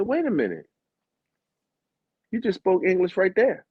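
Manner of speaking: mock-baffled, pitch lifted; retold incredulity. Traveler catching an airport stranger speaking English, incredulous.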